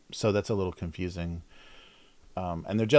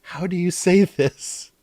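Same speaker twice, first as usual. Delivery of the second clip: mock friend calling, higher and louder; excited friend calling over; 8 semitones higher, 9 dB louder than usual. Streamer reenacting a record-store friend calling him over loudly. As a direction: call them over, eager to ask.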